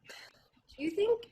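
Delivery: high breathy whisper. Acted. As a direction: whisper it close. Whispered drunk question.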